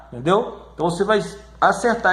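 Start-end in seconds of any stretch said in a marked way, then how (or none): none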